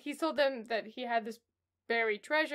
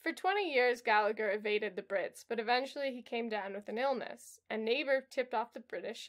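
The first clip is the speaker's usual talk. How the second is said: normal talking voice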